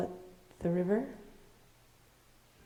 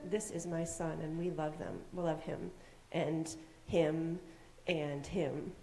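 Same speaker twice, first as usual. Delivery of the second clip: soft, lower, understated parents' declaration; 3 semitones lower, 7 dB quieter than usual. Acted. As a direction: say it softly, as the child's parents. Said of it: "Those people right there were saying".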